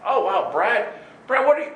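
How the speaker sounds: high, loud impressed exclamation; acted amazement. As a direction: play an admirer approaching eagerly. Voices people coming to Brad.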